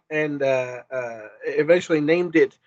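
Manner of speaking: ordinary talk, nothing marked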